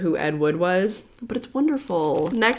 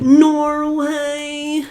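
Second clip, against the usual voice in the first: excited; 7 semitones higher, 5 dB louder than usual. Real, not acted. Excited exclamation opening video.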